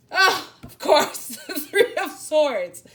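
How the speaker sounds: loud, slow, laughing of course; a big laughing groan at the card; drawn-out laughing recognition; knowing laugh. Real, not acted.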